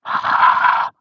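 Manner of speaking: big, forceful puff of breath; loud breath huff. Retold or imitated. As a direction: blow a hard, voiceless breath at him. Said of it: "I gave him a good whiff".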